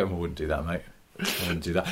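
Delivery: low, quiet knowing warning; hushed, wise old hand advising; old driver's warning. Retold or imitated. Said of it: "they can all just go and go".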